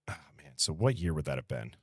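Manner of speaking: ordinary talk, nothing marked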